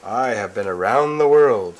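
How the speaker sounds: loud, grand boast; proud boast. Acted. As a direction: boast to the whole room. Prince boasting.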